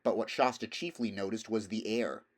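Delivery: ordinary delivery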